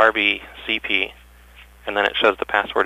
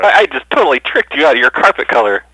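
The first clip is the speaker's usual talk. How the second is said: gloating; 4 semitones higher, 7 dB louder than usual. Pretend. Prank caller gloats at clerk.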